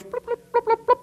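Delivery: high electronic blips; imitated signal blips. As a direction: beep like a space radio.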